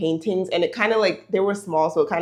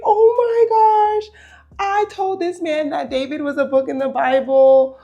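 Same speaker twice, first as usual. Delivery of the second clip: much higher, louder panicked texting; freaking out; 9 semitones higher, 5 dB louder than usual. Retold or imitated. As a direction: squeal it out in panic. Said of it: "I'm, like, freaking out".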